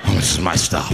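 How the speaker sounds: very low, sheepish mumble-like voice; back to his senses. Acted. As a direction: drop deep, suddenly composed. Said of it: "He came back to his senses".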